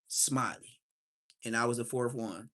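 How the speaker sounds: normal talking voice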